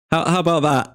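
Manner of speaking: high, cocky challenge; excited. Real, not acted. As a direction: dare the chat to doubt it. Bold prediction to chat.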